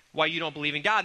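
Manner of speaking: usual speaking voice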